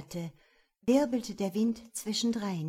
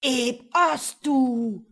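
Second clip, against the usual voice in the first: slow, loud, booming spell chant; incantation; 5 semitones higher, 10 dB louder, slower than usual. A narrator's reading. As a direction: intone the spell like a dark mage. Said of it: "sprach der Magier erneut den Schlusssatz".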